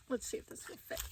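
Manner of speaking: hushed whisper in the closet. Real, not acted. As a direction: whisper so nobody hears. Hiding in closet, unvoiced.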